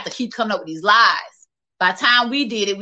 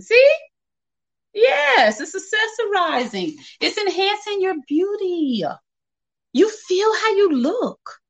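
excited agreement; 9 semitones higher than usual. Real, not acted.

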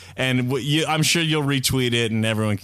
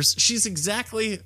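ordinary delivery

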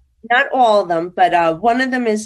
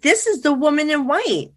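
excited insistence; 8 semitones higher than usual. Retold or imitated. Retells insisting on her discovery.

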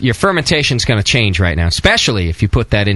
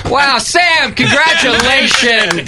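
mock grand congratulations; 12 semitones higher, much slower than usual. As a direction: gush it like a host.

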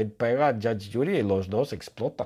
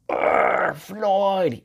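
furious mock yell; 7 semitones higher than usual. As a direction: growl that rises into a high cry. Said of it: "Ella grita". (grito furioso y exagerado)

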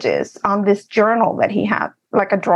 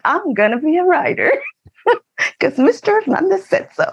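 higher-pitched playful bragging, laugh creeping in; mock cocky boast; 7 semitones higher than usual. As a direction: show off like a proud kid, half joking. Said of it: "toda chiflada, lifting my collar".

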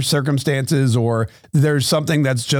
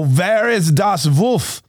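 slow, higher-pitched mock German villagers; mock German accent; 4 semitones higher, much slower than usual. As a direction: demand it slowly in a hammed-up German accent. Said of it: "a bad word in German".

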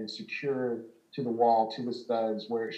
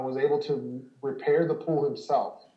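ordinary talk, nothing marked

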